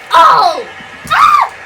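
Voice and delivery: scream. Acted. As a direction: let out a startled shriek. Character shoved out screaming.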